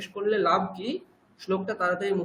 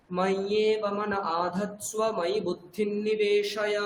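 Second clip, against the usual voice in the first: slow, deliberate Sanskrit verse; measured verse reading; slow recitation; slower than usual. Shown as a demonstration. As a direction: chant it slowly for the student to copy. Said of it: "teacher models the next verse".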